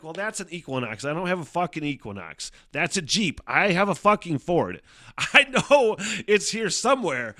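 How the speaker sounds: exasperated, laughing through the last line; retold frustrated muttering. Searching the parking lot for his Ford, loud and exasperated. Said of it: "I'm like".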